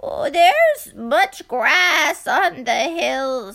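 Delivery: slow, loud reassurance; slow loud old man. Acted. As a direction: reassure them slowly. Old man answers cats.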